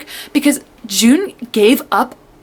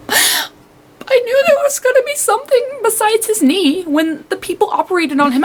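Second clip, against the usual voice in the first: worked-up outburst; 12 semitones higher than usual. Real, not acted. Reader reacts to Day's secret being revealed, worked up. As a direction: wail it out, overwhelmed by the reveal.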